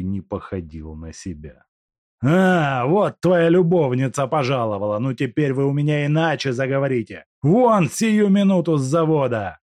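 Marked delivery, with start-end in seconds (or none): 2.3-9.6 furious shout. Acted. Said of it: "закричал он на Степанова".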